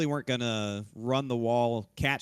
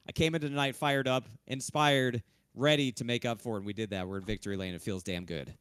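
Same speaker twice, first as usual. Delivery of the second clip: ordinary talk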